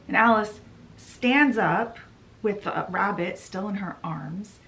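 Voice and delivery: ordinary delivery, nothing marked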